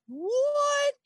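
high, laughing disbelief; retold giddy amazement at the coin; excited disbelief. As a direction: squeal it out in giddy shock. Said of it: "I was so excited".

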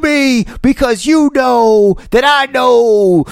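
high, loud, slow heel taunt; wrestling promo. Pretend. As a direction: sneer at the booing crowd. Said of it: "do it like a wrestling promo".

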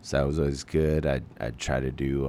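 ordinary talk

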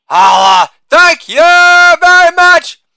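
Holla barked loud, then thank you very much stretched slow and very high; shouted catchphrase. Acted. Comic to audience, hammed-up sign-off, loud high drawn-out shout. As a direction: belt the sign-off like a showman milking applause.

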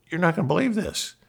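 high, quick burst of news; retold excitement. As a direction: rush it out high, bursting to tell.

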